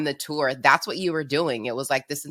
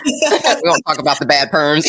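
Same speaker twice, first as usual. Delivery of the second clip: loud, through real laughter; joking through a big laugh; 4 semitones higher, 8 dB louder than usual. Real, not acted. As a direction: crack up while saying it. Friend laughing at teasing memory.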